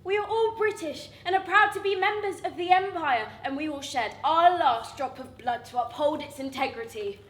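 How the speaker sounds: proud, loud declaration of loyalty; proud speech. Retold or imitated. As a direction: deliver it like a patriotic address. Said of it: "He said".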